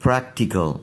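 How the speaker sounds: प्रैक्टिकल with an a sound and a short final अल; model pronunciation. Shown as a demonstration. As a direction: say it clearly as a model. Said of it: "अब देखिए इन अल्फाज को". (साफ़ उच्चारण)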